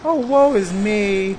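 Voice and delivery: slow hammed-up lament; milk the self-pity like a stage tragedian; mock whining. Cabin boy whining to the bosun about his clothes, hammed-up woe. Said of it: "Stow your whining".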